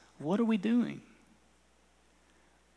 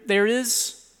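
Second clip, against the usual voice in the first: normal talking voice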